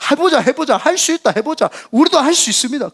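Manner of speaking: rally them with rising excitement; urgent can-do enthusiasm; fired-up rallying cry. Retold or imitated. People fired up by the vision.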